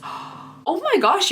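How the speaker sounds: sharp gasp, then a slow, high oh my gosh; gasping surprise. Real, not acted. Finding a full-size product in her bag, gasping surprise. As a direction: gasp as if you can't believe your luck.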